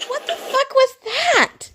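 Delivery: high, slow disgusted shock. Real, not acted. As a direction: recoil in disbelief. Reacting to gross eating.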